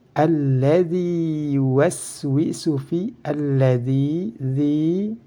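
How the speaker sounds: slow recitation, "Alladzi" with a long i on dzi; chanted "Alladzi yuwaswisu fi", long vowels held; two-beat long vowel. Shown as a demonstration. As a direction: stretch the last vowel of "Alladzi" and "fi". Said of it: "harus dibaca dua harokat".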